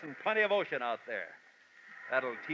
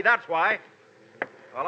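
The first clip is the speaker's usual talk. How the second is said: ordinary talk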